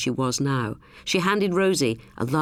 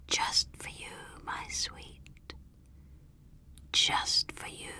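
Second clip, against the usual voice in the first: slow, breathy stalker's whisper down the phone; sibilant whisper; 10 dB quieter, slower than usual. A narrator's reading. Caller whispering to Rosie on the phone, slow and sibilant. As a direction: hiss it like a caller gloating in the dark. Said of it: "that sibilant, whispering voice on the telephone".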